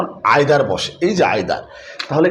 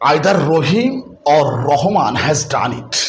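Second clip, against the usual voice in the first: very slow example sentence; teacher dictating the example sentence slowly; slow dictation; 2 semitones lower, much slower than usual. Shown as a demonstration. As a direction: read each word slowly for the class. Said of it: "যদি এভাবে বলি".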